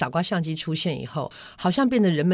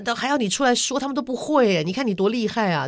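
admiring praise; 6 semitones higher than usual. Retold or imitated. Daughter retelling how she flatters her mother, admiring. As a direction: gush over her like a fan.

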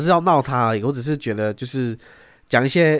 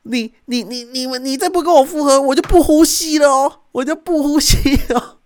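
high, loud whining threat, much higher; mock whiny boyfriend; 13 semitones higher, 6 dB louder than usual. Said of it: "就是说". (模仿男友尖声威胁)

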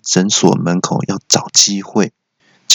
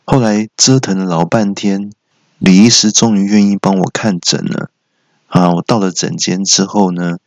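usual speaking voice

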